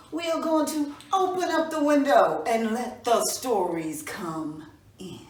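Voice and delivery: plain reading voice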